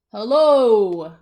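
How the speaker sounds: big, loud storybook holler; shouted greeting. Acted. Tortoise greeting the cactus, shouted. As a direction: holler it, stretching the word out. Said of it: "shouted a tortoise".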